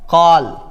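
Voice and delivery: "कॉल" with an open aw; in-between vowel. Shown as a demonstration. Teacher modelling an English word, "कॉल" with aw. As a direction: sound "कॉल" between kaal and kol. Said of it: "हम बोलेंगे इसको क्या".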